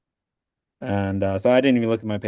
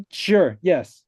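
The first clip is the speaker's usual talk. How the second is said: eager yes; 7 semitones higher, 4 dB louder than usual. Retold or imitated. Agreeing, retold.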